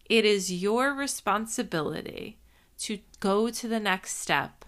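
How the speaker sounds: ordinary talk, nothing marked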